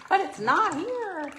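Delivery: high-pitched mock customer voice; quoted customer complaint. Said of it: "She goes".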